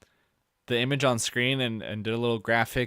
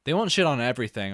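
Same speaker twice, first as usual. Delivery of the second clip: ordinary talk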